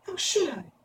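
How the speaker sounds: put-on high-pitched girlish voice; little girl Dorothy. A narrator's reading. Dorothy tells the witch she didn't know, girlish protest.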